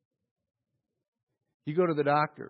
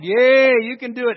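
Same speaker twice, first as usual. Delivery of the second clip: high, loud crowd cheer; excited crowd hollering encouragement; 6 semitones higher, 10 dB louder than usual. Acted. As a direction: cheer him on like a crowd at the falls. Crowd cheering the tightrope walker.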